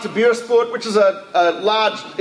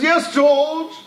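put-on posh English accent; 6 semitones higher than usual. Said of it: "upper crust English couple".

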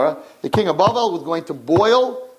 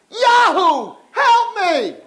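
high desperate scream; screaming for help; 4 dB louder, much slower than usual. Acted. King in the boiling pot screaming for help. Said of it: "he started to scream the name".

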